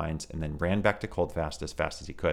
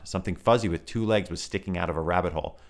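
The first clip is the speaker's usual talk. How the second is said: plain reading voice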